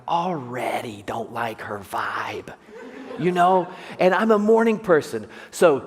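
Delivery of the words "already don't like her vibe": slow whispered aside; whispered thought. Retold or imitated. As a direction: breathe it out like a private grumble. Inner thought about barista.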